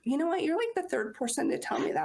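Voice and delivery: mock surprise. Friend's surprised reply retold.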